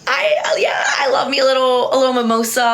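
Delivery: sudden high shout of delight; a burst of real excitement; excited outburst. Real, not acted. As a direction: blurt it out, thrilled. Excited about rooftop mimosas.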